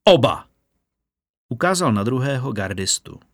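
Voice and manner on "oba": one louder barked word; captain's roar. A narrator's reading. Captain commands both guards, loud shout. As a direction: snap the order at both guards. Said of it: "zařval kapitán".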